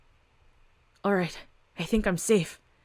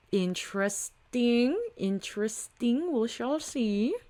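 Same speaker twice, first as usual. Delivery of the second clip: slow, softer, knowing villain murmur; sinister musing; 2 semitones higher, 6 dB quieter, slower than usual. Acted. Hidden watcher reacting to the news, slow and sly. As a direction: savour each word like a schemer.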